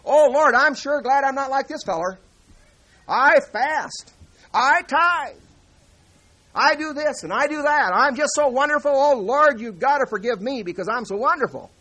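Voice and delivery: preacher mocks the proud man boasting to the Lord, high and loud; boastful Pharisee. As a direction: play the self-satisfied show-off praying. Boastful Pharisee prays in temple.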